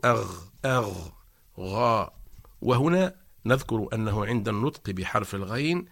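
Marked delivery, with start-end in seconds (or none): 0.0-2.1 غ from the back of the tongue, open; gargled غ syllables; ghain drill. Shown as a demonstration. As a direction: demo the open غ. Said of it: "فنقول".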